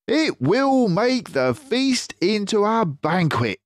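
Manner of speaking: high, loud, happy fox voice; delighted Mister Fox. Acted. Mister Fox tells friends the feast will be grand. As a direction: relish the thought.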